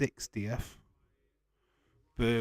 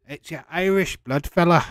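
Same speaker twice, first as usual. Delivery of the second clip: bad Irish accent; 6 semitones higher, 8 dB louder than usual. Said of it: "don't know what that accent was".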